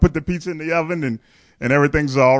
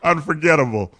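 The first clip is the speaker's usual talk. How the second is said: normal talking voice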